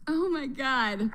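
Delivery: ordinary delivery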